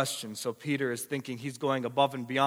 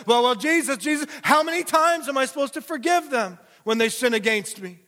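much higher, louder, eager Peter asking Jesus; high, eager disciple question; Peter pleading; 11 semitones higher, 7 dB louder than usual. Acted. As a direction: play Peter fishing for a pat on the back. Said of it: "Then Peter looks at Jesus and says".

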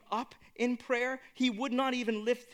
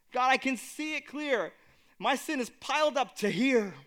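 higher and louder, pleading; anguished plea; 4 semitones higher, 6 dB louder than usual. Acted. Preacher voicing a sinner before God, anguished and loud. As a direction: cry out under the weight of sin.

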